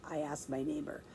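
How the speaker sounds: plain reading voice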